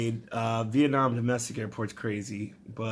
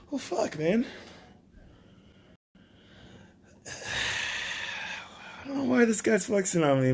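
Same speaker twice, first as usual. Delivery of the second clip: defeated whine; 9 semitones higher, slower than usual. Retold or imitated. Deflated reaction.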